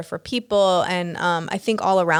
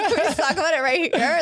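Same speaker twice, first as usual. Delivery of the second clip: much higher, strained plea; pleading squeak; 8 semitones higher than usual. Retold or imitated. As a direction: lift the whole question high and anxious. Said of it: "I was like".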